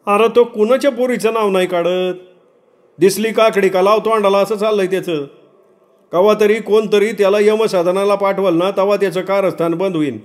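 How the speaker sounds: high-pitched village grumbler; high, aggrieved rustic voice; indignant villager. Acted. Villager complains about the boy.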